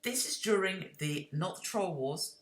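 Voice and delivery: ordinary talk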